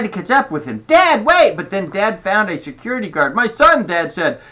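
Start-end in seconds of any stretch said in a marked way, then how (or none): 0.9-1.6 high, loud urgent shout; calling after someone. A narrator's reading. Girl calls after running father. 3.3-3.9 high, loud alarm, acted breathlessness; out of breath. A narrator's reading. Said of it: "He sounded out of breath".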